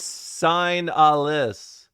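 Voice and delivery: "sign a list" slowly, SIGN like the English word; spelled-out pronunciation. Shown as a demonstration. Streamer correcting viewers on the game's name, slow pronunciation demo. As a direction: stretch out "sign a list" syllable by syllable, loudly. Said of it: "It's signalis".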